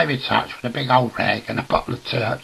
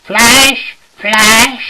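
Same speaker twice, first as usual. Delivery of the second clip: high, loud comic housewife call; drawn-out high yell of a name; shouting upstairs; 10 semitones higher, 11 dB louder than usual. Acted. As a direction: call up the stairs at full pitch. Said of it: "she shouts up the stairs".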